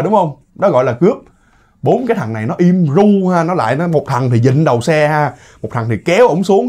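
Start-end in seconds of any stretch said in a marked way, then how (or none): none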